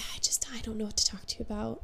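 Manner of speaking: fast, soft, unvoiced mumble; awkward whisper. To a new acquaintance, awkward whispered mumble. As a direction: rush out the awkward admission under breath. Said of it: "I'm like".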